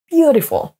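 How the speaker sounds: "Beautiful" joined up, high BEAU then falling; first-syllable stress. Shown as a demonstration. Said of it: "accentués sur la première syllabe".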